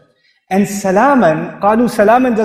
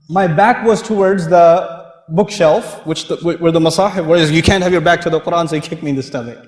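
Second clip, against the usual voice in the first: ordinary talk, nothing marked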